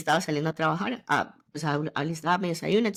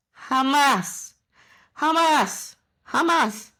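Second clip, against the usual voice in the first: each jamás louder and higher, falling hard; emphatic jamás; 7 semitones higher, 5 dB louder, much slower than usual. Real, not acted. Athlete vowing to her followers, forceful repeated jamás. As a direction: hammer home jamás three times.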